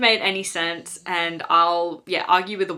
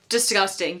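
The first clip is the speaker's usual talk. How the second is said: ordinary delivery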